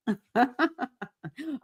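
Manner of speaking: light, high laugh. Real, not acted. Speaker amused by her own Southern voice, laughing. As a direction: laugh at your own bit.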